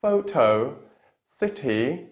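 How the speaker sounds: polite British request with released T's; British true T. Shown as a demonstration. Teacher shows what the British say, British accent. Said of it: "what the British would say".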